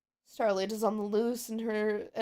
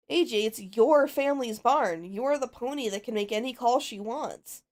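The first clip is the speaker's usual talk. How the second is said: ordinary talk, nothing marked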